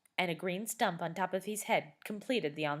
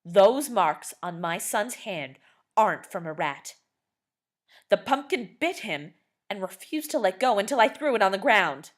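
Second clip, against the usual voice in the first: loud, indignant shout; 3 semitones higher, 10 dB louder than usual. A narrator's reading. Father rejecting Missus West's excuse, loud indignant shout. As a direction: throw the words back at her. Angry father insists pumpkin bit.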